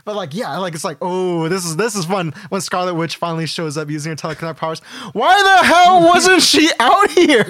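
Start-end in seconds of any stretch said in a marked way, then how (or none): 5.1-7.4 high, drawn-out comic yell; incredulous shout. Acted. Fan reacting to Scarlet Witch's late arrival, incredulous shout. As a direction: shriek it in mock outrage, stretching the words. Acting out outraged disbelief at fight.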